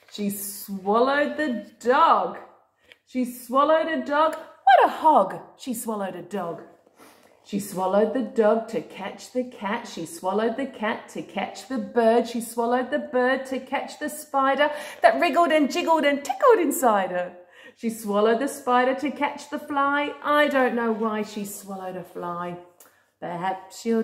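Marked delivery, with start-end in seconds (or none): 0.1-4.3 singsong storybook read-aloud; reading to a toddler. Acted. Reading a picture book to a child. 4.3-5.4 exaggerated. Playful mock disgust. 5.6-22.6 singsong storybook read-aloud; reading to a toddler. Acted. Reading a picture book to a child.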